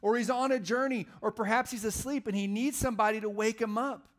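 high, loud taunting; preacher hamming up a taunt; mocking trash talk. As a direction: goad them with mock-helpful suggestions. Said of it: "Those are Elijah's words".

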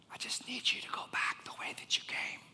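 full unvoiced whisper; hushed, breath-only divine whisper. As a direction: whisper it as a secret instruction. God's whisper acted out to Elijah.

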